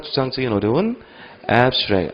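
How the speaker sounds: ordinary talk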